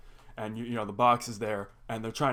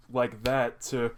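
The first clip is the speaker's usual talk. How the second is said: normal talking voice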